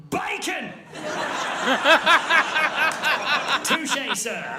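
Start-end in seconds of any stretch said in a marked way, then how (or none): 0.1-0.7 yelled heckle. Retold or imitated. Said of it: "a man in the audience yelled out".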